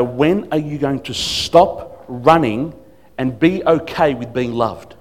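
slow, deliberate, earnest reproach; stern fatherly plea. Retold or imitated. Pastor holding a runaway member by the shoulders, slow fatherly firmness. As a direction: spell it out slowly like a concerned father. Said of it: "Almost felt like a father".

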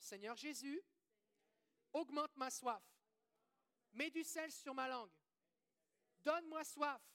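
fervent call-out prayer with pauses; led prayer. Real, not acted. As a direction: proclaim it phrase by phrase for the congregation to repeat. Leads congregation in repeated prayer.

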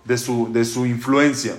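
calm him gently, unhurried; gentle reassurance. Acted. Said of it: "Hashem le dice".

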